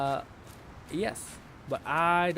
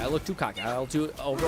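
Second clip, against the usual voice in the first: normal talking voice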